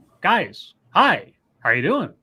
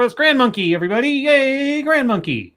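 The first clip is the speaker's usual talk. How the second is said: higher, swooping hooray; playful cheering; 9 semitones higher than usual. Acted. Host waves off apology to celebrate the child, playful cheer. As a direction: cheer for a little guest like a crowd.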